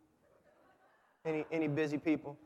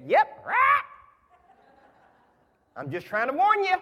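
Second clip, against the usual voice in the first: much higher squawking bird voice; squawky parrot; 7 dB louder than usual. Acted. The parrot answers the burglar in a high squawk. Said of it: "The parrot said".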